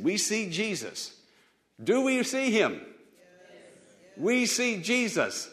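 rising preacher refrain; preaching. Acted. Preacher calling the refrain to the church. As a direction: call it out to the congregation.

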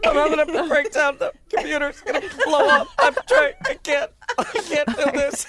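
high, mock-tearful laughing whine; computer having breakdown. Host as the computer failing at a long name, mock breakdown. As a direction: play a computer falling apart, half laughing. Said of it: "she sounds like she's starting to cry".

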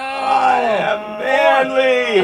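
high, slow, shouted boast; mock-heroic boast. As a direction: proclaim it like a hero. Mock triumph after push-ups.

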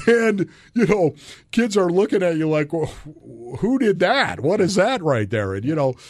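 fighting back tears as the story hurts; voice breaking. Real, not acted. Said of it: "I get emotional, as you can tell".

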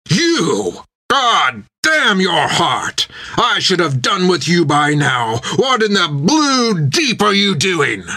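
high, slow captain's roar of rage. A narrator's reading. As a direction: roar at him as an enraged captain, ending in a scream. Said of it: "the captain began to roar".

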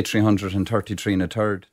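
Irish accent. Shown as a demonstration. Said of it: "try and use the accent".

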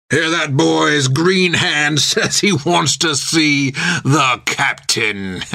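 high, drawn-out sailor mockery ending in a laugh; jeering first mate. A narrator's reading. First mate mocks green hand before his crew, jeering and laughing.

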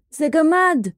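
high-pitched excited cry; boy's shout. A narrator's reading. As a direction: shout a discovery like a boy. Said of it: "צעק הילד מדוכן הנקניקים". (צעקה גבוהה של ילד)

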